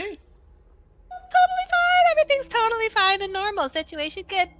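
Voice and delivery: high, strained "totally fine"; nervous. Acted. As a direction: pretend all is well, too high. Nervous denial after scare.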